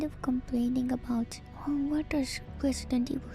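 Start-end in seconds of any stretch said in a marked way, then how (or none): none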